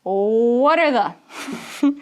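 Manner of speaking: "What are the" slurred slowly into one word; words joined together. Shown as a demonstration. English teacher showing learners linking, words joined slowly. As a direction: stretch "What are the" as one linked sound. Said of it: "This is all going together".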